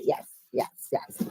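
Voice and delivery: soft whispered yeses. Real, not acted. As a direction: breathe the words quietly. Whispered agreement.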